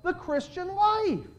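high, slow, whiny mock of a resigned believer. Preacher mocks people who say you can't live it, whiny mock voice. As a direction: mimic the hopeless excuse, drawn out high. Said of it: "a lot of people today that say".

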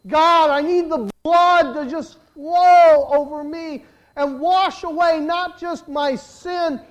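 loud, high, impassioned cry to God; shouted pulpit prayer, building in intensity; desperate prayer. Acted. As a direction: plead at full force, drawing out the words. Preacher models desperate prayer to God.

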